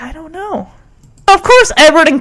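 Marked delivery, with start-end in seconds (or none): 0.0-0.7 very soft, small imitated voice; meek, barely-there reply; timid answer. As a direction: let the answer nearly vanish. Voicing Julie's timid answer.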